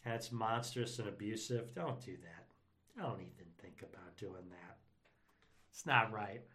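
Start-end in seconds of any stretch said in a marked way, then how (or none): 0.0-4.8 very soft, low admonishment; hushed, feigned moral horror; mock-solemn disapproval.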